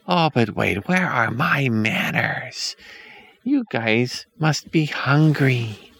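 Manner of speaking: put-on high, slow, sugary old woman; sweet witch voice. A narrator's reading. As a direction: coax them in a sickly sweet voice. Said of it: "the woman says".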